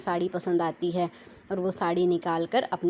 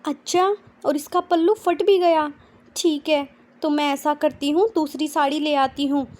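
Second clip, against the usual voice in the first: put-on much higher, louder astonished girl; surprised; 8 semitones higher, 6 dB louder than usual. A narrator's reading. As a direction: gasp at the price in disbelief. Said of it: "हैरानी जताते हुए कहती है".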